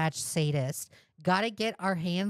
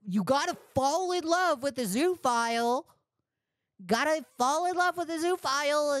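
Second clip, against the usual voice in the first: much higher mocking justifier voice; mocking impression; 6 semitones higher than usual. Streamer parodying the zoo justification, high mock voice. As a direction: lampoon his logic, singsong. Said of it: "You think he was like".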